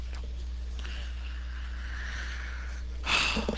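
long breathy whispered sigh of frustration; drained whispered exhale. Real, not acted. Frustrated after dying in match.

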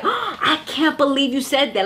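sharp intake of breath, then disbelief; mock shocked gasp. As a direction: imitate being appalled, with a gasp first. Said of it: "because you gasped at".

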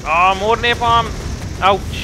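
loud, high outburst at the hits; excited outburst. Real, not acted. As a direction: cry out at the explosions. Napalm hits his units.

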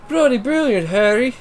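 high, hammed-up British exclaim; mock British. Shown as a demonstration. Practising a British catchphrase, hammed-up British. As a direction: toss off a cheesy British line. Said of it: "I'm gonna do the Oni British".

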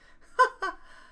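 loud high chuckle; a hearty high laugh; character laugh. Acted. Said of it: "Mister Winky laughed".

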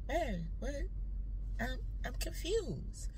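soft, hesitant, bewildered reply; meek confusion. Retold or imitated. Wife taken aback by the partner's outburst, softly confused. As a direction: shrink back quietly, confused.

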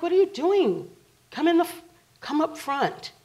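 loud, high retold scolding; exasperated alarm. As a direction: call out in baffled urgency. Retold exasperated order at car.

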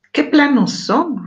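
amazed. Acted. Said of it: "responde Teo admirado".

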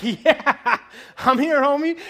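loud, high, laughing reply; happy. Retold or imitated. Pastor answering DJ's greeting, loud happy reply. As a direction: grin back at him. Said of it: "And I'm like".

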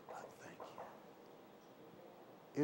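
slow breathy whisper of thanks; whispered prayer. Real, not acted. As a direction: breathe it out, barely voiced.

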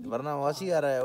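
normal talking voice

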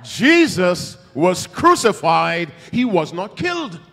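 loud, slow preaching proclamation; emphatic repeat. Real, not acted. Preacher repeating his point to the church, booming. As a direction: thunder it out to the congregation. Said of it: "Let me say it again".

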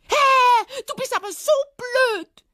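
very high, loud scolding squeal; angry whiny rabbit. Acted. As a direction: squeal the insult after him. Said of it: "schimpfte er ihm wütend hinterher". (wütend hohe Hasenstimme)

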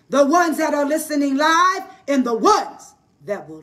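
loud, rising preacher's prayer; praying over the listeners, building in power; fervent prayer. Acted. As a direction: lift them up in prayer with full force. Prays over the live audience.

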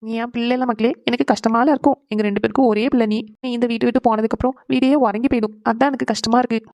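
usual speaking voice

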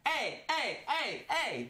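high, playful call for attention; excited hey. Real, not acted. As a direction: hype up your own joke. Hyping up own joke.

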